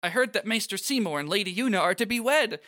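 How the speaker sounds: high-pitched excited gossip; excited Shalinda. Acted. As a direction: gush the gossip in a high bright voice.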